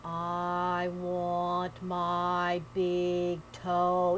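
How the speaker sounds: low, very slow moaning ghost. Acted. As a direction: drag every word out, low and hollow. Ghost voice in haint tale.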